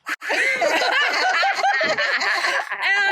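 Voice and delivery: big loud burst of laughter. Real, not acted. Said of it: "And this is part of the story".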